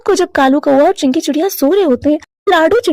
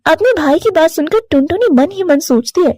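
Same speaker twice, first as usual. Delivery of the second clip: ordinary delivery, nothing marked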